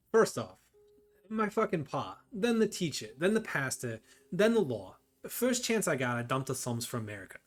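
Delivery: put-on a mobster's Irish accent that keeps slipping into Boston; a vague, drifting Irish mobster accent; muddled Boston-Irish accent. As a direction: tough Irish-Boston gangster pitching a deal, accent wandering. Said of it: "I don't know what accent you had there".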